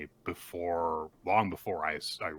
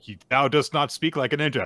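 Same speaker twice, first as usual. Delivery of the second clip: mock old-fashioned ninja; 6 semitones higher, 8 dB louder than usual. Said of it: "and then Momoa's like".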